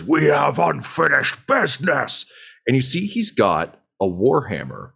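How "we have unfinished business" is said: slow, higher, lightly voiced threat; a hint of a brute picking a fight; menacing thug voice. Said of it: "he says".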